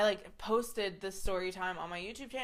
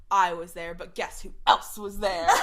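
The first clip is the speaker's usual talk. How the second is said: louder hammed-up theatrical announcement; most dramatic voice; 6 dB louder than usual. Sean walks into rehearsal with a secret, dramatic. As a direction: imitate a drama queen making a reveal. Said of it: "In, like, the most dramatic voice".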